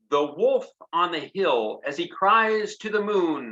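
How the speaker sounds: lively high sing-song reading; poem reading. A narrator's reading. Teacher reads the cowboy poem to children, high and lively. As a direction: paint the wolf for the kids.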